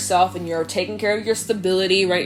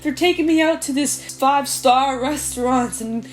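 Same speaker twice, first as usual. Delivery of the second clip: high, giddy voice of someone being flattered; swooning quote; 5 semitones higher than usual. Retold or imitated. Mimicking someone charmed by a flatterer, high and giddy. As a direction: gush like you're charmed by a smooth talker. Voicing a smitten target of flattery.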